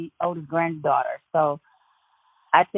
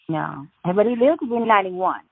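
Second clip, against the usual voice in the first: ordinary talk, nothing marked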